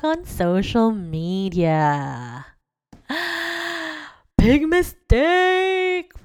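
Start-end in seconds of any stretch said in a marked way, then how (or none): 3.1-4.1 mock gasp. Real, not acted. Dramatic reaction to own story. 4.4-6.1 big mistake, drawn out slow and high; mock dramatic. Real, not acted. To viewers about checking exes, slow mock-dramatic.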